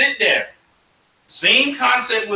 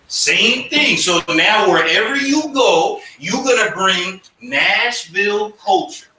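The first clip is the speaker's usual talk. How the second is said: ordinary talk, nothing marked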